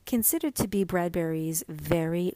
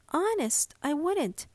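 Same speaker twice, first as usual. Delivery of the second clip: put-on high pleading boy; 8 semitones higher than usual. A narrator's reading. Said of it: "Joseph pleads".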